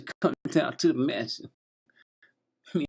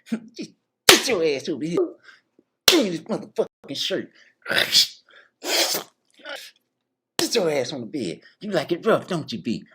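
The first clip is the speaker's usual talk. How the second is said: louder, pushy commands; mock rough order; 5 semitones higher, 6 dB louder than usual. Host ordering his guest around, louder and pushy. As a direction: bark it like a boss.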